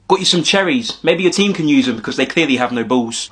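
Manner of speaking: ordinary delivery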